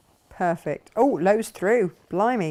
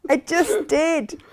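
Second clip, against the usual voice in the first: laughing; 8 semitones higher, 7 dB louder, much slower than usual. Retold or imitated. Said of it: "And you're like".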